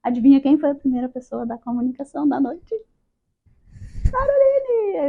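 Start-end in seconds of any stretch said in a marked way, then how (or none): 4.1-5.1 high, loud call of her name; a mock summons from the spirit. Called first to communicate. (chamado alto e agudo, em tom de brincadeira)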